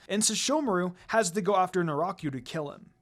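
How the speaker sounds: plain reading voice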